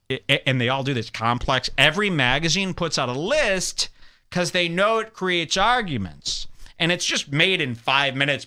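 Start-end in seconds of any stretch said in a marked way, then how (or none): none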